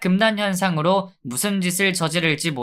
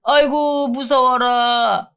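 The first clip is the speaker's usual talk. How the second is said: higher, slow, sing-song fake fright; mock fear; 7 semitones higher, 4 dB louder, much slower than usual. Inmate mocking the guard's warning, slow fake fright. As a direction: pretend to be scared, sarcastically.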